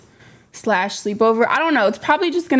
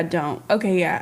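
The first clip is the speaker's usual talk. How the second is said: normal talking voice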